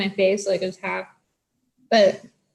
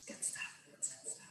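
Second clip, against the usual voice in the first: whispered; 27 dB quieter, slower than usual. Real, not acted. Repeated quiet murmur.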